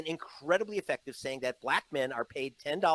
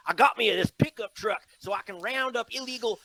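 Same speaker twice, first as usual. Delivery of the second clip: mock the governor's folksy Southern goober accent, pushing higher and louder; 8 semitones higher, 10 dB louder than usual. Mocking the governor's campaign ad in a hammed-up goober accent. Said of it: "he talks in this new goober accent".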